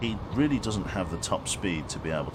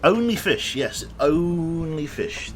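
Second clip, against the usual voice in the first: much higher, louder and slow, hammed-up dismissal; mock flippant; 11 semitones higher, 6 dB louder, slower than usual. Streamer mocking his own oil rig excuse, hammed-up high. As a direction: sing it out high and smug, slowly. Mocking callback of earlier line.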